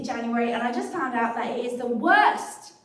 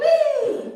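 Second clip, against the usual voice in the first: high, loud whee of glee; playful whee; 12 semitones higher, 6 dB louder than usual. Real, not acted. Panelist spinning the wheel, playful high whee.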